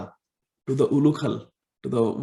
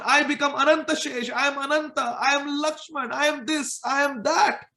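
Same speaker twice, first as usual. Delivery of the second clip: put-on much higher boastful child voice; proud child declaring; 10 semitones higher than usual. Balram boasts to Yashoda that he is Lakshman and Ananta, high. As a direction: declare each identity with childish pride.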